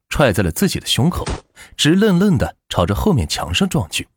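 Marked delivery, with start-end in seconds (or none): none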